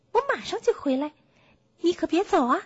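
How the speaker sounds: high, quick bear voice; hurried bear. Acted. Bear tells the donkey to wait for him, hurried. Bear rushes off, promising to return.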